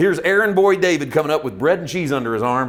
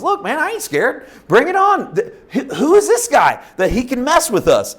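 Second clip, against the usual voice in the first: put-on high-pitched boyish bravado; cocky little kid; 9 semitones higher, 3 dB louder than usual. Young David answering his angry brothers, high cocky kid voice. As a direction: squeak out defiance like a cocky kid. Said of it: "David, cocky little kid".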